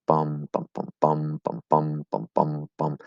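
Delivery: three long "bum" beats, two short, then alternating long-short; hendecasyllabic rhythm. Shown as a demonstration. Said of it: "It's gonna sound".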